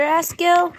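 plain reading voice

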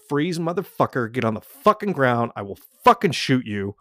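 retold police command. Retired cop to muggers at gunpoint, forceful command. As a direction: lay down the law, swearing hard. Acted armed command, unlike trained warning.

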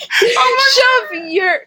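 high, loud laugh breaking into the words; laughing out loud at her own slip; laughing shout. Real, not acted.

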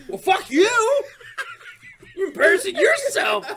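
high, loud laughing mock rant; hammed-up defensive dad yelling at his kid; defensive outburst. Dad mock-yelling back at his ten-year-old, laughing. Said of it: "I'll be all defensive and shit".

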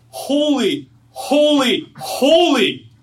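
loud, high cry of holy; angels' cry. Retold or imitated. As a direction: call it out like the angels. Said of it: "this is what they say".

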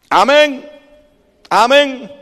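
loud, high rallying call; calling for response. Real, not acted. Preacher prompts congregation for response. (llamado fuerte y agudo, animando a responder)

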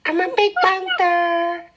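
very high, squeaky mock Scottish voice; high squeaky Scottish-accented panther voice. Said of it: "That's his Scottish accent".

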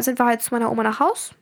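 usual speaking voice

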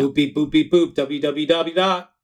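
high, loud mock computer beeps; robot beeping voice. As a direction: beep it out like a robot. Joking about tech and interwebs.

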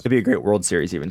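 ordinary delivery

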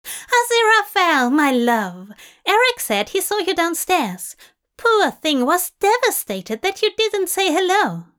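high, loud, theatrical society woman; gushing Tracy voice. A narrator's reading. Tracy sweeping in to greet the bartender, high and gushing.

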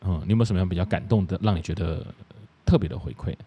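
ordinary delivery

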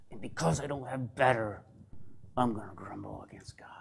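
fading from a low grumble into a whisper; grumbling whisper. Retold or imitated. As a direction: mutter the complaint under your breath.

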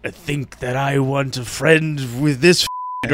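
slow, indignant affront; mock indignation. Sandman huffily rejecting friendship, slow mock indignation. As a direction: act offended, drawn out. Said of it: "And he's like".

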